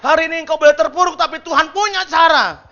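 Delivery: high, loud exhortation; full-force preaching. Real, not acted. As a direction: proclaim it to stir the congregation. Preaches at full force over music.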